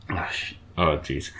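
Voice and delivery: holding back tears, voice fading; shaky sigh. Real, not acted. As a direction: near tears, barely audible sigh. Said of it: "I'm trying not to cry".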